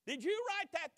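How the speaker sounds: loud, high accusing question; accusing demand. Retold or imitated. Church court grilling Luther, loud and accusing. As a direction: bark the charge at him.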